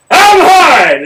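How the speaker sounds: loud, high barked command; drill sergeant yell. Retold or imitated. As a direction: bark it at the whole warehouse. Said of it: "I had the loudest voice".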